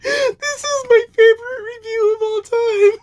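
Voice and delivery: real laugh-crying, voice cracking high; laughing to tears. Real, not acted. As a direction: squeal it out while laughing so hard you tear up. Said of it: "I'm gonna cry".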